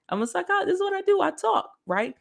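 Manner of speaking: high teasing parody of her own line; mock high boast. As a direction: pitch it way up to tease yourself.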